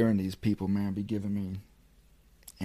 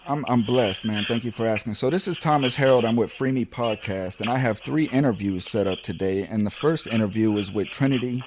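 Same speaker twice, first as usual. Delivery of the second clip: loud phone greeting; 3 semitones higher, 8 dB louder than usual. Real, not acted. Greeting prison officer on phone.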